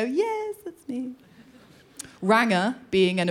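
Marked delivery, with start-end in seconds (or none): 0.0-1.1 meek answer. Retold or imitated. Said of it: "and you go".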